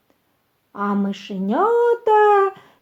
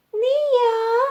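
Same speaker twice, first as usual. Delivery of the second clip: very high, squeaky little refusal; little mouse refusing; 12 semitones higher than usual. A narrator's reading. First mouse refuses the rooster's work, high squeak. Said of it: "отказує одне мишеня".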